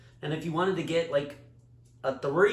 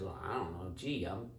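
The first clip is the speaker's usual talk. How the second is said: very low, soft dopey tree; quiet, puzzled tree voice; talking tree; 8 semitones lower, 13 dB quieter than usual. Acted. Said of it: "And the tree says".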